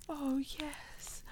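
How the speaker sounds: breathless whisper. Acted. Catgirl in the window, whispering in awe to her partner. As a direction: whisper it as if overcome.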